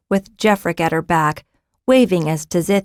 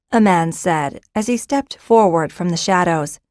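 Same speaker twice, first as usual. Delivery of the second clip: plain reading voice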